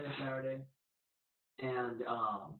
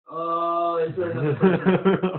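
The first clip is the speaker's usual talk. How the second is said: laughing mock alarm; 4 semitones higher, 17 dB louder, slower than usual. Mock surprise on steep hill.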